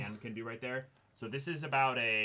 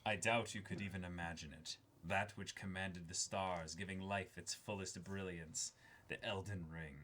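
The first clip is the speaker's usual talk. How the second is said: dramatic trailer read; 6 semitones lower, 9 dB quieter than usual. Acted. Reads box text dramatically.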